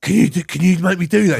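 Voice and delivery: high-pitched eager pleading; excited. Retold or imitated. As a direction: beg like you've just had a brilliant idea. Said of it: "I got really excited".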